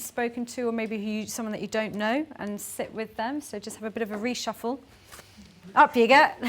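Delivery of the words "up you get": louder, higher call to get moving; brisk, raised facilitator's call; calling out to the group. Real, not acted. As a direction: rouse the room with a lifted voice. Group told to stand up, called out.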